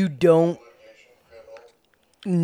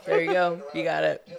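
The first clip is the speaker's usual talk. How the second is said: usual speaking voice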